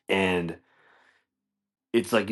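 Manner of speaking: usual speaking voice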